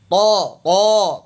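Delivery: تو as a thick, drawn-out "To"; heavy Ta. Shown as a demonstration. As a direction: say each تو slowly with a full heavy T. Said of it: "تو موٹا ہے". (طا موٹا، "تو" کھینچ کر)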